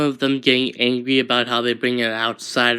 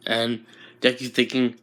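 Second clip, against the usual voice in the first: usual speaking voice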